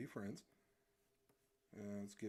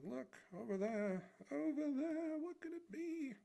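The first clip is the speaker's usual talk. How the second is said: much higher, louder calling out; excited pointing; 5 dB louder than usual. Retold or imitated. Streamer voicing the pointing bystander, high eager call.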